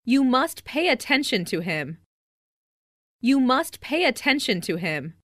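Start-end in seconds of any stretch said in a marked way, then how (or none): none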